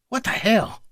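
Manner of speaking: high, stunned exclamation. Acted. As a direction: disbelief at the impossible. Object makes impossible turn.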